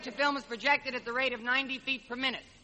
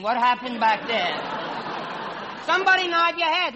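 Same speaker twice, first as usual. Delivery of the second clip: exasperated call; 5 dB louder than usual. Real, not acted. Host with the wrong sheet calls to stage crew, exasperated. Host pleading with confused backstage.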